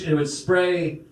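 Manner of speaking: usual speaking voice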